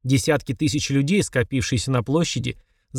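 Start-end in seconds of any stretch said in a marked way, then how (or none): none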